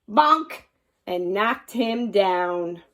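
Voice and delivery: plain reading voice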